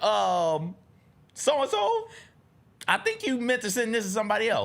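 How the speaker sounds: high, polite put-on voice; awkwardly polite high voice; mock polite reply. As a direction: gently point out the mistake in a high voice. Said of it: "I was like".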